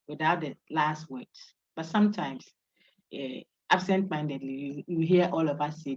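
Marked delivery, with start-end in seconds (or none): none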